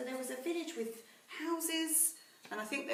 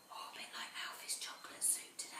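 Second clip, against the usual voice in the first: whispered aside; 10 dB quieter than usual. Real, not acted. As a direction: lean in and whisper it.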